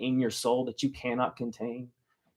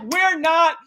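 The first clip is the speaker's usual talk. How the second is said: much higher and louder, slow pleading protest; desperate protest; 14 semitones higher, 8 dB louder, slower than usual. Retold or imitated. Boy protesting to the operator as the ride starts, loud and pleading. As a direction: drag it out in panic.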